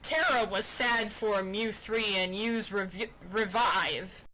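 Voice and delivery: ordinary delivery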